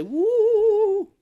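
a high, loud ghostly woo; spooky woo. Retold or imitated. Retelling a spooky movie moment with a mock ghostly woo. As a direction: hoot like a cartoon ghost. Spooky lights-flicker imitation.

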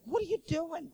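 high-pitched imitation of a wife's challenge; disapproving wife. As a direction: question him in a put-on high voice. Wife challenges him in story.

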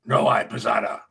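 a gruff innkeeper turning them away. Acted. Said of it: "He gruffly says".

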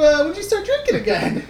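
retold daughter shocked at her mom, squeaky and laughing; incredulous daughter. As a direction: ask it high, laughing in disbelief. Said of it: "Karen's like".

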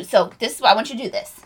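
ordinary talk

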